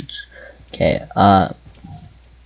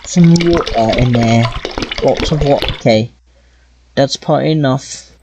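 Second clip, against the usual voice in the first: half-hearted British accent while playing. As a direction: chat along in a mock British accent. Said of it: "Gonna do the rest of this with a British accent".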